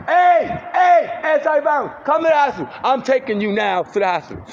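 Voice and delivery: high, loud yelling at a stranger; furious yelling at a stranger who cursed at her; furious shout. Real, not acted. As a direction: scream him down in the street. Yelling at passerby.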